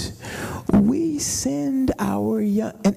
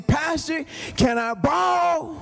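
mimicking the young man; 8 semitones higher than usual. Imitating the young man asking.

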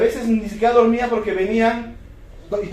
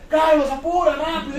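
impatient father; 7 semitones higher than usual. Retold or imitated. Father urging son.

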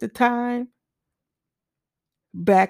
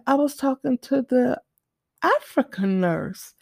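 put-on higher, light little-girl voice; 4 semitones higher than usual. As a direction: speak like a small sick child. Daughter voiced in story.

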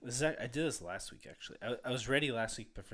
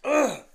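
throaty ugh; 13 semitones higher, 15 dB louder than usual. Real, not acted. Clearing throat, disgusted.

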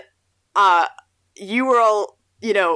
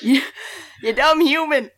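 scornful mock insult; 7 semitones higher than usual. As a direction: spit it out like a mocking demon. Mocking the evil spirit line.

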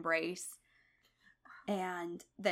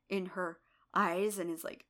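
usual speaking voice

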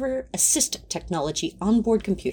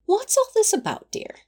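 lilting higher innocent question; feigned innocence; 11 semitones higher than usual. Acted. Angel feigning innocence to an outraged Crowley, lilting higher. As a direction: play the innocent, all sweetness.

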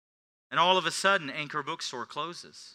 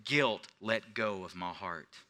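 ordinary talk, nothing marked

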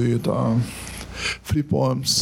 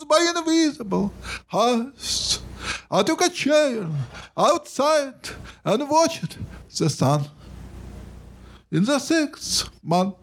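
higher, slow poem recitation; poetry reading; 11 semitones higher, slower than usual. Acted. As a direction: build the list line by line. Reads his poem aloud.

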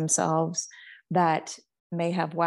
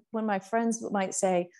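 usual speaking voice

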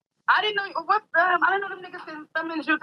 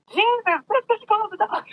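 high, squeaky mock baby kangaroo yelling; mock squeaky joey; 9 semitones higher, slower than usual. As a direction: play the baby kangaroo, high-pitched and indignant.

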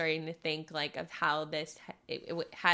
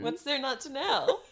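sarcastic; 8 semitones higher, 5 dB louder than usual. Pretend. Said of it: "I'm being sarcastic".

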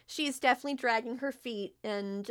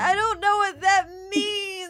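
high, very loud hammed-up wail; loud sobbing; 9 semitones higher, 12 dB louder than usual. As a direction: bawl it like an overwrought girl. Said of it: "She just sobs loudly".

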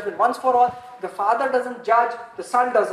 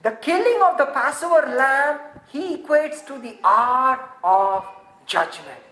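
high, loud and slow preaching; impassioned sermon climax; fervent slow preaching; 6 semitones higher, 5 dB louder, slower than usual. Acted. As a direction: lean on every word for the congregation. Preaching at full force.

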